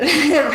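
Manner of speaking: a short, loud, high laugh that bursts out; real burst of laughter. Real, not acted. Trainer laughing at the customer's imagined reply, loud and high. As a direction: laugh out loud at your own joke.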